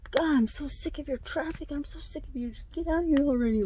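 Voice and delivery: high-pitched fed-up complaint, imitating; retold exasperation. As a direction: act out a fed-up rant. Retold neighbour fed up, confronting.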